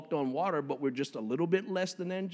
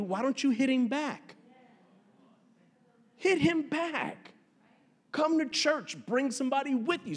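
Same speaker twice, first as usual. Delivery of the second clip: preaching exhortation; 10 semitones higher than usual. Real, not acted. Preacher urging to fight back.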